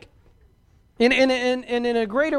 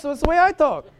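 Mexican accent; 6 semitones higher, 6 dB louder than usual. Shown as a demonstration. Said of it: "this is the way he talks".

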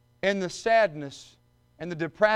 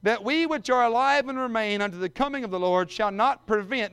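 ringing, raised pulpit reading, higher and louder; preaching scripture; 5 semitones higher, 5 dB louder than usual. Acted. Preacher reading scripture to the congregation, ringing and raised. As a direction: proclaim the verse to the whole church. Preacher reads Thessalonians aloud.

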